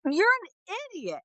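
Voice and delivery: high scolding; exasperated. Retold or imitated. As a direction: call them an idiot, exasperated. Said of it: "I was like".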